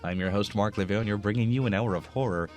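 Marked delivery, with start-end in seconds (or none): none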